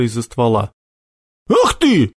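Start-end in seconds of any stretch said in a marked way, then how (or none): 1.5-2.1 high-pitched furious outburst; angry cry. A narrator's reading. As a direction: cry out in spite. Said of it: "зло вскрикнул Сашок".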